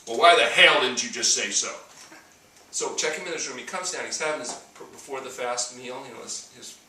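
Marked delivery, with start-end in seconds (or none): 0.1-1.7 gruff patient. Retold or imitated. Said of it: "And he says".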